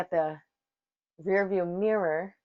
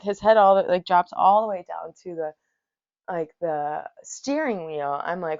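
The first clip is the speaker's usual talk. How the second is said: ordinary talk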